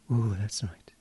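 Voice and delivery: soft whispered reassurance. Acted. Hypnotist telling the listener he is unconscious, whispered. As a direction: whisper them down into sleep.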